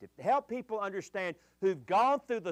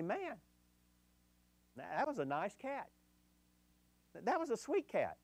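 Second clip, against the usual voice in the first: soft musing; 3 semitones higher, 6 dB quieter than usual. Retold or imitated. Quoting husband's soft comment.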